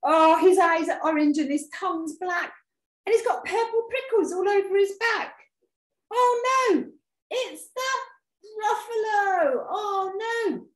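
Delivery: high, loud mock terror; hammed-up horrified recognition; horrified mouse. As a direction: cry out in storybook dread.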